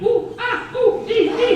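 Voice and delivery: squealing high yelps in the shower; hammed-up shower squeals; high squeals from hot and cold water. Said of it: "like that".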